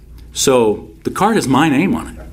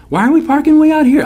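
put-on a much higher, louder, quick girl's voice; high puzzled date; 5 dB louder, faster than usual. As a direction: ask it like a baffled teen date, shrill and high. Date's puzzled question voiced.

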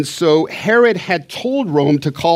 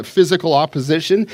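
ordinary talk, nothing marked

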